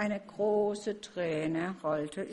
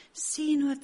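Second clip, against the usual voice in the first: high, loud little sparrow chirp; chirpy sparrow wife; 7 semitones higher, 6 dB louder than usual. Acted. Sparrow wife shows Max the apple tree, high and chirpy. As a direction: chirp it out like a small bird. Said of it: "zwitscherte sie".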